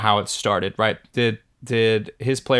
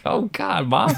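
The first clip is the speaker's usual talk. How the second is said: laughing through a retold groan at mom; laughing exasperation; 7 semitones higher, slower than usual. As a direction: say it while breaking into laughter. Laughing at mom's remark.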